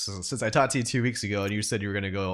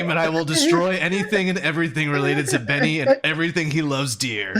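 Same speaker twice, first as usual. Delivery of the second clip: loud, high-pitched vow of revenge; Mike; 6 semitones higher, 6 dB louder than usual. Acted. Imitating a beaten player vowing to destroy his rival, loud raised voice. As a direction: declaim it as a hammed-up threat. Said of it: "Was that a good mic impression?".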